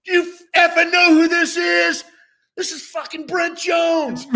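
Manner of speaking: high, loud hammed-up yell at the whole store; imitated yelling. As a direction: bellow it like a starstruck fan showing off. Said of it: "he starts yelling".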